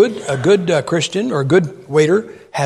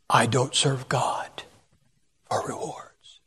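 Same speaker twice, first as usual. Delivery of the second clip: hushed, slow holier-than-thou voice, fading to a whisper; mock the self-righteous with a soft, slow whisper; mock pious; 6 semitones lower, 10 dB quieter, slower than usual. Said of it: "Like they're spiritual".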